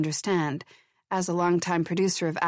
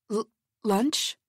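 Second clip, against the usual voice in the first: halting, thrown-off stammer; stammering surprise; 4 semitones higher than usual. A narrator's reading. Producer caught off guard by the chemist, stammering. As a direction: caught off guard, stumbling on the word. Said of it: "Walter Pine managed".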